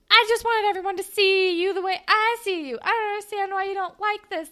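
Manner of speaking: high, loud imitation of insistent protest; leaning into it. Girl justifies showing his photo, leaning into it loudly. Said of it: "leans into it and is like".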